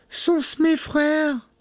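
very high, drawn-out falsetto question; hopeful wife. A narrator's reading. Wife asking her sister, high and hopeful. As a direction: ask it slowly in a high woman's voice.

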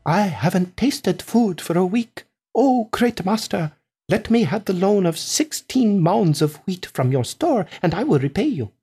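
a touch of supplication; humble pleading. A narrator's reading. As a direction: plead humbly with a great master. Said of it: "as humble as could be".

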